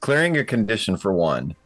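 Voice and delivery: usual speaking voice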